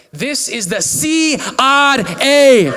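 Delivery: put-on loud slow scam caller with Indian accent; super loud Indian accent. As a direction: bellow it like a scam call agent with an Indian accent. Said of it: "just, like, super loud".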